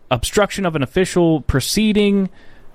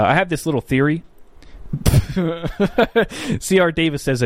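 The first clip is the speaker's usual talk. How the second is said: ordinary delivery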